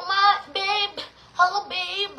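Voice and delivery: a lightly voiced spoiled trendy child; mock trendy kid. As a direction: sneer at the "babe" kids. Mocking kids' trendy English.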